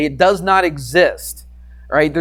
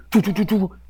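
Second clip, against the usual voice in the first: high, quick "doo-doo-doo" patter; light, high vocal sound effect; imitated running noise; 6 semitones higher than usual. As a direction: mimic scampering feet with your voice.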